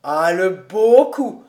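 loud, slow delighted cry; loud jolly cheer; laughing exclaim. A narrator's reading. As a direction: hail the great shot with glee. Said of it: "partit d'un éclat de rire en disant".

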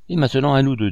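sneering villain turning on his rival; mocking and dry. A narrator's reading. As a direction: throw down the challenge with a scoff. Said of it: "dit-il d'une voix railleuse et sèche".

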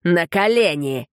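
slow, drawn-out command; yelled command. A narrator's reading. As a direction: order him down, drawn out. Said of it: "заорал на него Тит".

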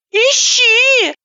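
high, slow, sing-song lady's voice; high-pitched woman on the phone. Acted. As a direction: play her as a theatrical, fluttery woman. Vlasova's voice on the phone.

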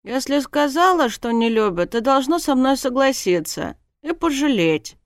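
whiny protest demanding sympathy; indignant. A narrator's reading. Heroine scolds her disobedient subconscious, indignant. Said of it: "возмутилась я".

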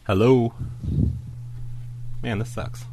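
ordinary delivery

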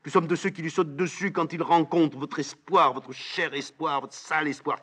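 high, fierce tirade; heated defiance. Acted. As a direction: spit out contempt at a high pitch. Antigone en pleine dispute avec Créon. (révolte véhémente, voix haut perchée)

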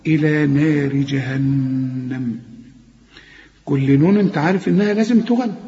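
ordinary talk

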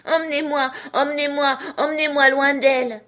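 put-on high, loud, desperate child's wail; a little girl crying out to be taken away; a child's frantic lament, rising into near fury; furious wailing child. A narrator's reading. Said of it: "elle éclata en une lamentation presque furieuse".

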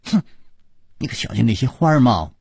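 rushed, jumping in quickly; eager girl butting in fast; cutting in. Acted. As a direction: blurt it to grab his attention. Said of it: "她立刻抢着说".

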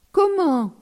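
sharp, high, loud surprised "Comment?"; startled. Acted. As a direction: jolt up in surprise. Priest startled by the question.

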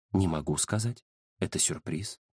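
hushed low reply; secretive fortune-teller. Acted. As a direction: keep it back, low and soft. Fortune-teller withholding the prediction. (тихий, низкий, загадочный голос)

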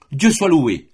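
loud, high cry of relief; exclaiming. A narrator's reading. Giuseppa cries out in relief, loud exclamation. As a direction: cry out thanks. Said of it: "s'écria Giuseppa".

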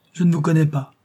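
put-on stubborn small boy; defiant child. A narrator's reading. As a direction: push him away in a child's voice. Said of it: "la voix enfantine".

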